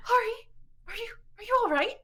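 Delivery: high, hesitant, anxious question; worried check-in. Acted. Gris looks over at slumped Ari, high worried voice. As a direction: falter as you check on a hurt friend.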